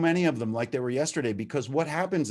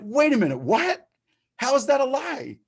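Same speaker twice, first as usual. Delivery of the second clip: much higher, louder, incredulous; worked up, disbelieving the lie; recovering addict at a meeting, getting fired up; getting hyped; 11 semitones higher, 3 dB louder than usual. Retold or imitated. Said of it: "I'm getting hyped now".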